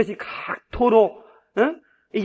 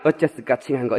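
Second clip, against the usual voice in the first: Jeolla-dialect aside, pitched lower; 6 semitones lower than usual. Acted. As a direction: drop down into a Jeolla-dialect tag line. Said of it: "전라도 버전 다시 한번 읽어주려고 그래".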